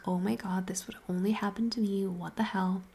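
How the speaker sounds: ordinary delivery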